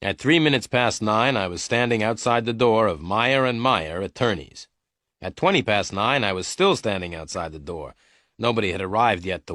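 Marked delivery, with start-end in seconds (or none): none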